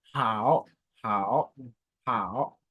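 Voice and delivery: Hao dipping low; third tone. Shown as a demonstration. Teacher drilling Hao for students. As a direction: drag Hao down and up. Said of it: "le troisième ton".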